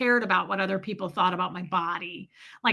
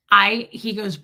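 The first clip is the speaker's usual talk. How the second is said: ordinary delivery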